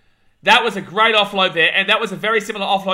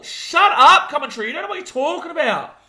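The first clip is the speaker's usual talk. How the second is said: 1 exasperated; 7 semitones higher, 3 dB louder than usual. Real, not acted. Scolding the TV commentators.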